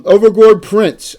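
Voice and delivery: slow, loud prize call; loud slow announcement. Real, not acted. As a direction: draw out the prize name loudly.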